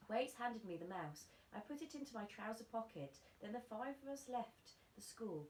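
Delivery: ordinary delivery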